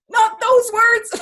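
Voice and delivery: high, loud, slow mock-outraged cry; shocked father. Father reacts to the pee threat, high mock outrage. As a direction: act like a dad horrified by the words. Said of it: "my father's like".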